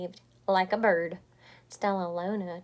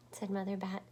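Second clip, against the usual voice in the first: plain reading voice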